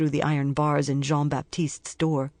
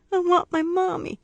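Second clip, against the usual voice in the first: put-on high child's voice crying for mommy; a little kid calling out for mom; small child wailing.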